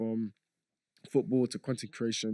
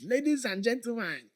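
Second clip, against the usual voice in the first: put-on high, booming Doctor Abu accent greeting the crowd; 11 semitones higher than usual. On stage in Singapore, greeting the audience in the Doctor Abu accent. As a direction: open like Doctor Abu in his comic accent. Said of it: "I did it for, like, a few seconds".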